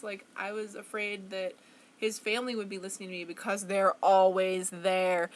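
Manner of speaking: usual speaking voice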